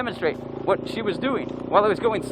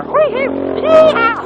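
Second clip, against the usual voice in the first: excited whoop; 4 semitones lower, 6 dB louder, much slower than usual. Real, not acted. Cheering on a berm.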